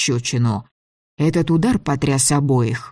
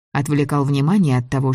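ordinary delivery

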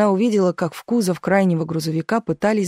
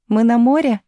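put-on higher, louder boyish question; eager child's question; 5 semitones higher, 5 dB louder than usual. A narrator's reading. Tolik to his mother over the suitcase, eager question. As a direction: ask it brightly, like a child. Small boy asks mother.

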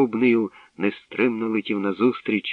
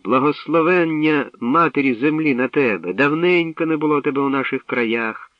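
glad welcome; 7 semitones higher, 5 dB louder than usual. A narrator's reading. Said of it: "радо привітав він його".

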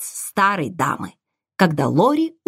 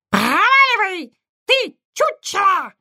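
screeching parrot; 12 semitones higher than usual. A narrator's reading. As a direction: shriek it like an angry parrot. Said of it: "завизжал Попка".

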